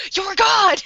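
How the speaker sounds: high, loud mock scream; hammed-up, high-pitched fanatic cry; retold scream. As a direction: scream it in wild awe. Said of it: "just like, just screams it".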